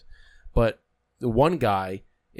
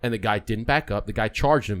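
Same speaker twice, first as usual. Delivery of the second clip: ordinary talk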